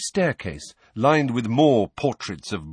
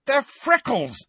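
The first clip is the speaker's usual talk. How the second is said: high-pitched exasperated outburst; furious; 3 dB louder, slower than usual. A narrator's reading. Boy correcting a portrait about his freckles, furious. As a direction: burst out in furious protest. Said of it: "said Ron furiously".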